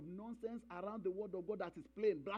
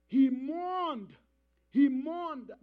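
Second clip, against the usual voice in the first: loud, higher preacher's declaration; forceful preaching; 6 semitones higher, 9 dB louder, much slower than usual. Acted. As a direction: proclaim it to the congregation.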